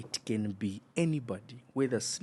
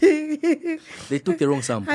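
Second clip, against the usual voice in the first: excited squeal; 9 dB louder, slower than usual. Real, not acted. Interjected punchline, laughing.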